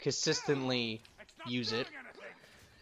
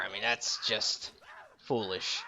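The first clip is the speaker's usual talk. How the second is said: ordinary delivery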